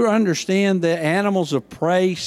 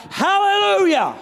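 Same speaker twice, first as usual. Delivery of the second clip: soaring high shout of praise; shouted hallelujah; 3 dB louder than usual. Shown as a demonstration. Preacher showing the church how to shout hallelujah. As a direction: shout it like a football crowd. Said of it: "why can't the church ... shout".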